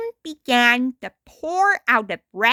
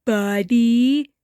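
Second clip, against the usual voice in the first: Stimpy voice, questioning. A narrator's reading. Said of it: "asked Stimpy back".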